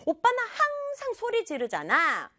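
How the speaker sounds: high-pitched acted complaint; anger bursting out. Fed-up sister accuses brother, acted anger. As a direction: stretch 항상 in exasperation. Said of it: "분노가 터지는".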